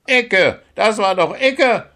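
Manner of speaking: high, loud crow's protest; outburst. A narrator's reading. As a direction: cry out in indignant protest at the referee. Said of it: "entfuhr es der Krähe Gundula".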